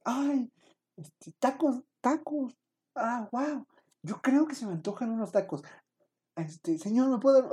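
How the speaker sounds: high, bubbly chatty lady voice; cheerful lady customer. Retold or imitated. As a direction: play the overly upbeat lady ordering tacos. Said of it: "representación de la señora".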